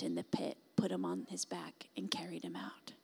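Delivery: sudden low hushed whisper. Real, not acted. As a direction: drop to a whisper for the rescue. Climax of rescue story, unvoiced.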